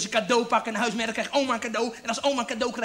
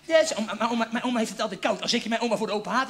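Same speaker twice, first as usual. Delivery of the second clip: ordinary talk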